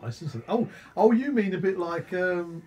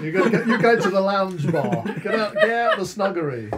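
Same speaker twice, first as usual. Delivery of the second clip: laughing; 4 semitones higher, 6 dB louder than usual. Real, not acted. Teasing friend with burning mouth.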